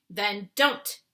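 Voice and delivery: sharp giant's retort. A narrator's reading. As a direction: snap back at Sophie. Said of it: "answered sharply".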